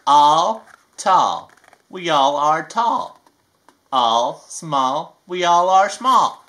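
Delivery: slow, sing-song picture book reading; reading to children. A narrator's reading. Reading a rhyming book to kids, sing-song. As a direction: read each rhyme to a little one.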